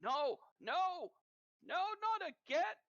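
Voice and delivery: a shade in your mind pleading not again; panicked voice. Acted. You hear this voice.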